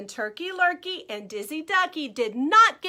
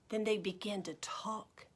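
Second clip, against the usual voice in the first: soft story whisper; 8 semitones lower, 12 dB quieter than usual. A narrator's reading. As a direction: whisper as if a secret. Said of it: "narrator whispers".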